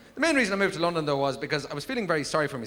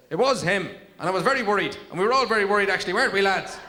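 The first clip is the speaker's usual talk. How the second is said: higher, louder voice of an anxious sheep; sheep piping up; 6 semitones higher, 5 dB louder than usual. Acted. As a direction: pipe up as an indignant witness sheep. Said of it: "one of the sheep piped up".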